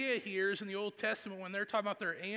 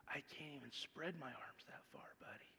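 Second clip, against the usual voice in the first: bedtime whisper; 2 semitones lower, 14 dB quieter than usual. Retold or imitated. Dad tells son at bedtime.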